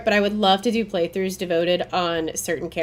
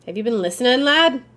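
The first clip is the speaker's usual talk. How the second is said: light Irish lilt on the NPC's line; a touch of Irish brogue; Irish accent; 6 semitones higher, 4 dB louder than usual. Acted. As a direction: read it like an old Irish villager, lad rising high and loud. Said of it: "I don't know why I read that as Irish".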